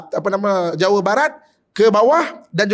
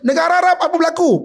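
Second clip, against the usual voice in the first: preaching shout; 7 semitones higher than usual. Real, not acted. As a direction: hurl the question at the crowd. (teriakan khotbah yang tinggi dan keras)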